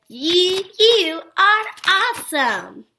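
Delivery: high, slow excited exclamation; really excited. Shown as a demonstration. Teacher reading an example for an exclamation point, really excited. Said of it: "because I'm really excited".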